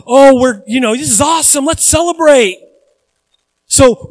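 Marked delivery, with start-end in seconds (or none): 0.1-2.6 high, loud mock cheer; giddy mock party voice; mock celebrating. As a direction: ham up a crowd cheering sin. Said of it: "you go".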